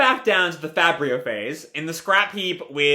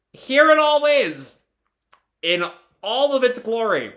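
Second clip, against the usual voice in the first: grand announcement; 7 semitones higher than usual. Real, not acted. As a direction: present it with a big flourish. Grandly announces long-awaited lore.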